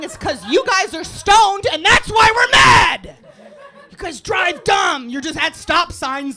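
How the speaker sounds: loud, high, drawn-out ranting; comic rage shout. Acted. Comic berating stoned drivers, shouted in outrage. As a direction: bellow like an exasperated driver.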